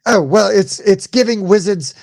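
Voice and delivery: hammed-up, vaguely English movie-comedy accent. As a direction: improvise a rough English accent, Monty Python style. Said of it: "vaguely English".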